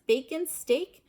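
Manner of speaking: plain reading voice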